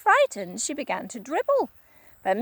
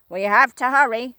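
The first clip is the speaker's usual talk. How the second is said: louder gruff urgency; a hint of a bossy old man; Mister Twit, urgent; 8 dB louder than usual. Husband rushing his wife, gruff. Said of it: "said Mister Twit".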